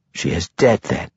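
matter-of-fact, unmoved delivery; flat statement. A narrator's reading. Matron Mother on the buried woman, stated flatly. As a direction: state it without emotion. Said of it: "the Matron Mother stated flatly".

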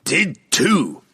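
high-pitched roaring dwarf retort; roared. A narrator's reading. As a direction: roar it back in dwarf voice. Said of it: "Bruner roared".

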